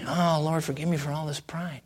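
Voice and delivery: soft, humbled confession; quiet inward prayer. Retold or imitated. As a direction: pray it privately, voice dropped right down. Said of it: "I would in my heart quietly".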